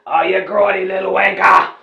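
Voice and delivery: put-on loud, jeering Australian accent; mock Australian accent, shouted. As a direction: yell it like an outraged Aussie. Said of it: "A couple Australians complained".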